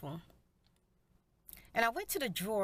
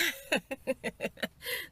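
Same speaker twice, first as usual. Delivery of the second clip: laugh; 3 semitones higher than usual. Real, not acted. Laughs after ending story.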